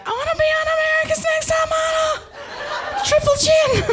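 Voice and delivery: very high, rushed squeal; absurd, hammed-up high-pitched babble; hysterical outburst. Stoned absurd outburst to the cop.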